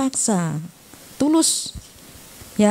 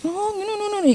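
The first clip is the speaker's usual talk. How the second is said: dismissive brush-off; 10 semitones higher, 3 dB quieter, much faster than usual. Retold or imitated. Friend brushing off parents' advice.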